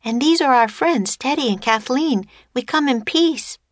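put-on high-pitched little girl voice; young girl. A narrator's reading. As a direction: pipe up like an eager child. Narrator voices young Annie.

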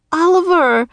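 high, loud happy call; joyful cry. Acted. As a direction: call out to the dog with joy. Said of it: "she cried".

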